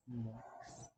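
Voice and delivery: low, soft, lingering MOIST; ASMR moist. Shown as a demonstration. Demonstrates the hated word.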